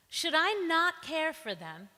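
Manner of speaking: loud, high pleading questions; God crying out for his people; rising, forceful rhetorical appeal; impassioned plea. Acted. God pleads over the city.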